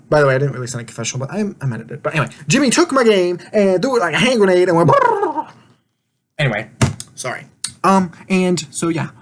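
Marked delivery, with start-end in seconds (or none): 2.5-5.5 higher, rolling out a grenade brrrr; mock grenade rant. Real, not acted. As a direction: toss the story off like a hammed-up explosion. Retells Jimmy blowing up game.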